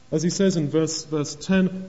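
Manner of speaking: ordinary talk, nothing marked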